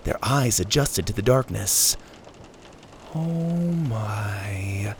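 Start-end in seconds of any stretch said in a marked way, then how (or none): 3.2-4.9 awed whisper. A narrator's reading. Awed first glimpse of treasure.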